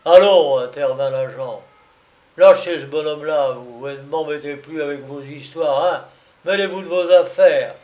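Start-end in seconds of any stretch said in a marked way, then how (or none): 0.0-0.6 loud, firm call to stop; stern intervention. Acted. The policeman cuts in on the quarrel, loud and stern. 2.4-7.7 louder, quick, fed-up order; annoyed policeman. Acted. The policeman orders the client to let go, annoyed and loud.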